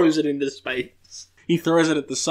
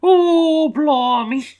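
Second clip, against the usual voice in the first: put-on much higher, louder, cheeky cockney British accent; squeaky, loud cockney wet wipe monster; hammed-up high cockney British accent; Tom Holland British; 14 semitones higher, 6 dB louder, slower than usual. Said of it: "Tom Holland British".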